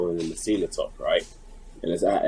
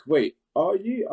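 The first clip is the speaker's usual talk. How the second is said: mock disbelief; 11 semitones higher, slower than usual. Fan realising who he is.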